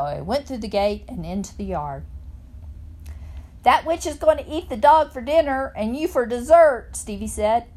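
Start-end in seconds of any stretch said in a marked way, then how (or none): 3.6-6.9 put-on loud, higher boyish taunt; taunting call. A narrator's reading. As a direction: call a scary warning after her. Boy taunts her as she enters.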